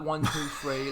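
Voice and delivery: a touch of Essex, East London; rough stab at East London; East London accent. Shown as a demonstration. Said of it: "it was Essex".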